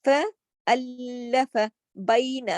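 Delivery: بَيْنَ with 'ai' as in کئی; ya leen in baina. Shown as a demonstration. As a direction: glide بَيْنَ, not بینا. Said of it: "اس کو بینا نہیں پڑھیں گے". (بَيْنَ کئی کی طرح)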